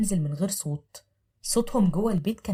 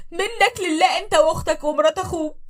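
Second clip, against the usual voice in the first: high, loud crying protest; screaming and crying; 10 semitones higher, 5 dB louder than usual. Acted. Wife crying out as she is dragged from the house. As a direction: scream through tears. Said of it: "بتقاوم وبتصرخ".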